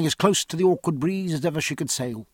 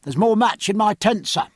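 plain reading voice

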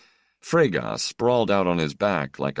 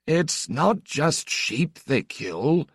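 put-on high, slow, hesitant old priest; tentative old priest; 12 semitones higher, much slower than usual. Old priest to the stranger, hesitant, high and slow. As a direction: offer it timidly like a shy old priest. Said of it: "said Father Agius tentatively".

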